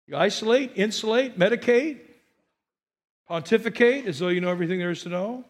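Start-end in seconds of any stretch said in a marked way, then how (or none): none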